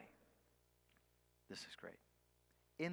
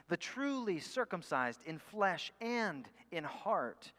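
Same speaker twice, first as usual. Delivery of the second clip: rising preaching; 3 semitones higher, 6 dB louder than usual. Acted. Climactic preaching passage.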